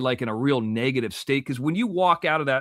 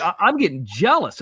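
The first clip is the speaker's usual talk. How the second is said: ordinary talk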